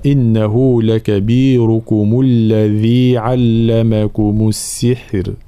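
slow tajweed recitation; تلاوة مجودة; Quran recitation. Shown as a demonstration. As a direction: recite slowly, clear the raa at the end. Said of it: "لابد من بيان حرف الراء".

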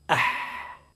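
breathy "أح" held until the breath runs out; voiceless sustained h. Shown as a demonstration. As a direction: let the h run on with the breath. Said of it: "نقولوا مثلًا". (حاء ممدودة بالنفس)

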